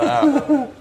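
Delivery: high imitated groan through laughter; high mock groan. Imitating the missus back next morning, high mock groan. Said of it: "she's like".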